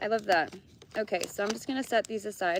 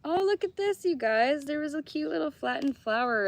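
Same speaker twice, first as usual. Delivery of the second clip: higher and louder, thrilled little discovery; light up over a small find; gushing over a pressed flower; delighted; 8 semitones higher, 5 dB louder than usual. Real, not acted. Finds a flower between cards.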